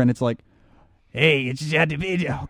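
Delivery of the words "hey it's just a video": slow, drawn-out playful mimicry; stretched, put-on playful voice; playful Jack Nicholson. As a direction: imitate an actor clowning for the camera. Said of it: "trying to be playful".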